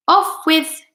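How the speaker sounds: a mild storybook shout. A narrator's reading. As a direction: yell it in a panic. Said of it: "shouted the centipede".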